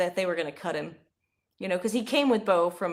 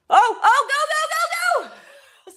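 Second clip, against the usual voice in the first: high, loud yelling that breaks into laughter; ecstatic cheering; 10 dB louder, much slower than usual. Real, not acted. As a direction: cheer him down the field, laughing. Cheering a long run.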